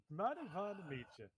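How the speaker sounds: hint of a Southern accent on a clown. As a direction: greet him with a touch of Southern twang. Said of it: "doing a southern accent all of a sudden".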